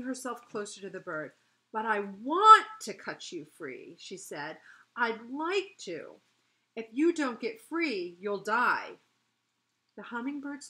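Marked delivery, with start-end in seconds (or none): 1.7-4.0 emphatic, louder burst of insistence; urgent storybook-heroine insistence; insistent plea. A narrator's reading. Fairy urgently pleads with trapped bird. 4.9-9.0 loud, emphatic insistence; pressing, punched-out plea; urgent warning. A narrator's reading. Fairy urgently warns trapped bird.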